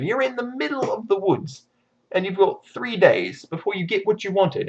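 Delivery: ordinary talk, nothing marked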